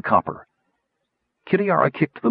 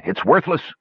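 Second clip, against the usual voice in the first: dismissive; 7 semitones higher than usual. A narrator's reading. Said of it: "the man commanded".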